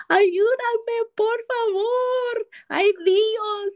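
high wailing plea in Spanish; imitating a pleading sleeper. Mother-in-law crying for help in her sleep, high wail. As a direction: cry out for help, high and drawn-out. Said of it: "didn't even sound like my mother-in-law".

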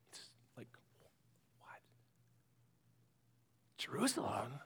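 unvoiced, shocked whisper; stunned whisper. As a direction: breathe it out in disbelief.

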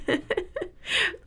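airy giggle, pitch jumping up; soft high giggle; breathy high laugh. Real, not acted. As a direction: laugh lightly at your own joke. Laughing at her own joke.

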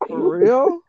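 high, loud for real; stunned high question; disbelieving for real. Retold or imitated. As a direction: ask in shock. Her reaction to boyfriend.

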